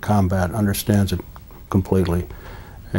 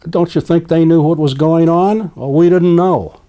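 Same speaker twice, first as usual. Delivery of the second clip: raised, indignant disbelief; 6 semitones higher, 4 dB louder than usual. Real, not acted. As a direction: demand it, then mock their excuse. Indignant rhetorical challenge.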